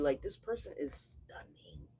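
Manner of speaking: breathless whisper of awe; awed whisper. Real, not acted. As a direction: whisper it like you can't believe it. Awestruck whispered reaction.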